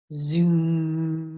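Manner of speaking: droning lawnmower sound; lawnmower zoom. Retold or imitated. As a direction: hum it like a motor. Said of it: "It goes like this".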